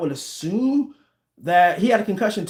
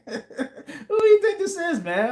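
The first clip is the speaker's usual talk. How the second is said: laughing; 11 semitones higher than usual. Real, not acted. Laughing off a viewer's taunt.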